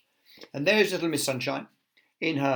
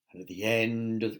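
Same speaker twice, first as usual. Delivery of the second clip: usual speaking voice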